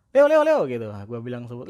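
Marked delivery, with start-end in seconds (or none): 0.1-0.6 very high and louder calling a cat. Retold or imitated. Owner calling his cat Leo from afar, loud and high. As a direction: call the cat from across the house.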